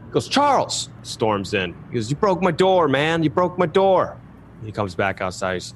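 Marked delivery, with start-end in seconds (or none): 0.3-0.9 very high shout of a name; angry call. Acted. Phil calling out for Charles, a very high angry yell. 2.1-4.2 high, whiny angry complaint; hammed-up outraged owner; upset complaint. Phil storming into the room, high and outraged.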